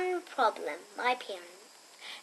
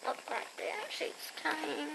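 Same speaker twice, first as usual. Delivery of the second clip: whisper; 14 dB quieter than usual. Real, not acted. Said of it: "whispered aside".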